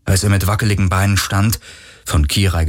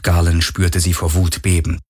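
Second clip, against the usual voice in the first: ordinary delivery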